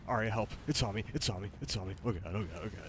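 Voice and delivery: panicked cries for help; frightened, pleading with rising alarm; scared horror game streamer. Real, not acted. Bird chasing him in game.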